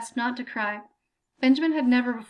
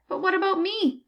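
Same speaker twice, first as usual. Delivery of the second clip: higher, pleading plea; desperate plea to the grader; hint of desperation; 8 semitones higher, 3 dB louder than usual. A narrator's reading. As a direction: voice starting to crack with need. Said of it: "a hint of desperation creeping into his voice".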